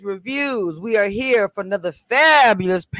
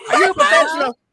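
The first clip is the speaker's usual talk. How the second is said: playful shout; 14 semitones higher, 6 dB louder, faster than usual. Real, not acted. Teasing loud question.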